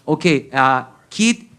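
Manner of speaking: put-on halting Spanish-accented English; slow, halting broken English; aunt's broken English. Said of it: "she goes".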